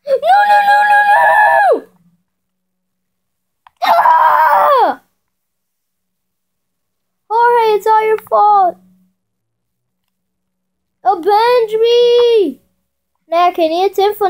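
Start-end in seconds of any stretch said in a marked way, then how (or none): 0.1-1.9 frantic yelling no. Real, not acted. Being knocked off in game. 3.8-5.0 shout. Real, not acted. Falling off in game. 7.3-12.6 slow, hammy dying plea; milk the dying words theatrically; overacted death scene.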